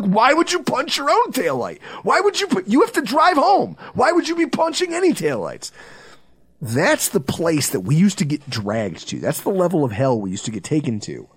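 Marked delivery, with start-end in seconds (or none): 0.0-5.7 high, incredulous ranting questions; high-pitched exasperated scolding; exasperated disbelief. Retold or imitated. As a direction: berate him, baffled. Narrator scolds friend in disbelief.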